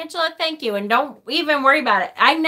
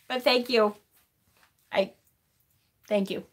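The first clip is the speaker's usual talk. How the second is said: softer, halting thanks; choked up; 7 dB quieter than usual. Real, not acted. Streamer thanking viewers after nearly crying, choked up. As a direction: thank them quietly, holding back tears. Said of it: "making me cry on camera".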